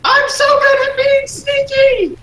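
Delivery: very high, loud, drawn-out squeal; mock scream. Player joking about Elias being sneaky, high mock scream. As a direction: shout it gleefully as a joke about stealth.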